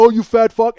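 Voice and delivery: high, loud jeer; yelled insult. Retold or imitated. Passenger yells at the man while rolling up his window, loud insult. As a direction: hurl it across the traffic. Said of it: "he's still yelling at me".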